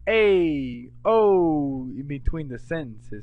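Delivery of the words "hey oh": loud Italian American Hey oh; Italian American filler, loud and sung; Italian American exclamation. Shown as a demonstration. Teacher demonstrates Italian American fillers, loud. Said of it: "They say".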